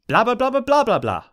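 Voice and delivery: high, mocking indignant babble; indignant blah blah. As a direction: parody her protest as nonsense. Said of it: "said Twilight indignantly".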